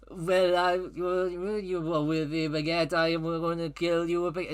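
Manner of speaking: garbled, hammed-up French gibberish; bad French accent. French racer threatening with a baguette, bad French accent. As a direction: mangle it as a mock Frenchman.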